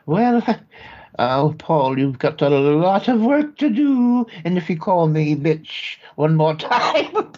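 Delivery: put-on high, fluttery elderly aunt voice; fussy old aunt. Aunt fussing over her nephews in a high old-lady voice.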